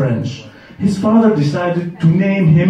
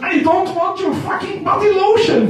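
much higher, exasperated outburst; hammed-up angry refusal; mock furious retort. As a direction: snap back at the pushy caller. Angry reply to telemarketer.

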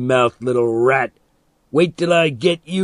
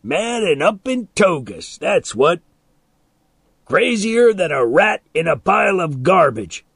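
higher, loud mocking jeer; goading taunt; 5 semitones higher, 4 dB louder than usual. A narrator's reading. Man mocks boy's mad father, goading. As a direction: rub it in with a raised voice.

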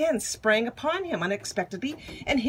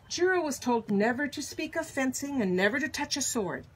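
plain reading voice

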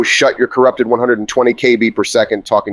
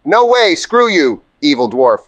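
high indignant; 5 semitones higher, slower than usual. Acted. Squeaky indignant retort.

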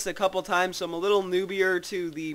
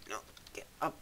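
whisper; 8 semitones lower, 15 dB quieter, much faster than usual. Real, not acted. Tense moment in game.

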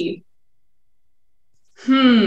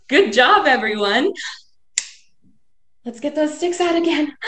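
ordinary delivery

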